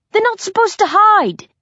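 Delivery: high-pitched shouted complaint; indignant shout. A narrator's reading. Hunter complaining about hiding prey, shouting high. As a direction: cry foul at the top of the voice. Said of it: "Essex shouts".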